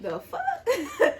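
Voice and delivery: high, laughing disbelief. Retold or imitated. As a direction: quote him through a laugh. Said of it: "he just looking at me like".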